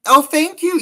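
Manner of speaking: excited thanks. Real, not acted. Thanking viewer.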